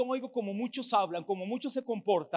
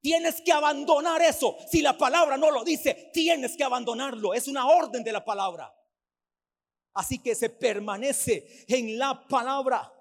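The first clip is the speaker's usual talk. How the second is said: loud, commanding preacher's call to drop it; preaching at full force; 4 semitones higher, 7 dB louder than usual. Acted. Preacher commands the congregation to abandon sin, loud and forceful. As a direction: thunder the order from the pulpit.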